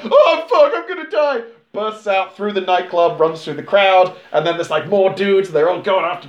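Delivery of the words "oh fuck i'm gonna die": high, loud panicked yelp; fleeing man in a towel, mock terror. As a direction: yelp it while running away.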